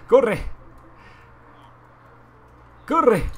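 loud high urging cry; urgent shout. Real, not acted. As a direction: yell run in a panic. Urgent in-game danger.

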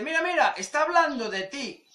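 high, excited mock voice; gleeful tattletale. A guy sending a video to his mate, gleefully stirring. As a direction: egg your mate on, delighted. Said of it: "le manda un vídeo a un colega y le dice".

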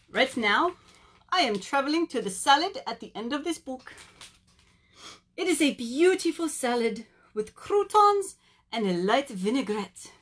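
playful, hammed-up French accent; bad French accent, showy snail; terrible French accent. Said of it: "do a really terrible French accent".

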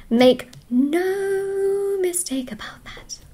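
slow, breathy whisper with a rising lilt; whispered reassurance. Real, not acted. Reader whispering a promise to viewers, slow secret whisper.

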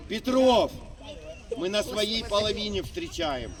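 normal talking voice

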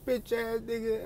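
high-pitched mock rant; mocking high-voiced cursing; mimicked ranting woman.

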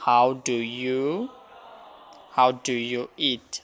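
slow, careful English sentence; slow English demo. Shown as a demonstration. Teacher reading an English example slowly to students. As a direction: spell it out slowly for the class. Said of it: "কি বলি?".

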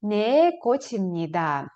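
Не кочімніда slow with a falling end; slow model answer. Shown as a demonstration. Teacher drills the answer slowly for students. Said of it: "ви скажете".

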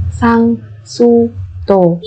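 slow, separated syllables for learners; careful teacher's slow model. Shown as a demonstration. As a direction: spell it out slowly, beat by beat.

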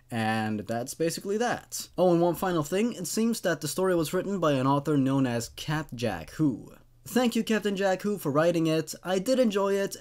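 ordinary delivery

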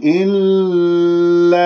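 "إلا" with the doubled ل held far too long in a tune; exaggerated sung "إلا", endless l then long aa; overheld lam. Shown as a demonstration. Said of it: "ولا تمسك اللام".

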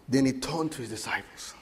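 ordinary delivery

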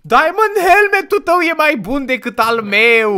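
high, excited protest; indignant amazement. Real, not acted. Player sees the partner's helmet is better, indignant and high. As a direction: blurt it out in high-pitched disbelief.

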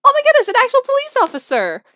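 high, loud, quick exclamation; excited high-pitched surprise; surprised. Real, not acted. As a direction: blurt it out in amazement. Reacts to officer appearing in game.

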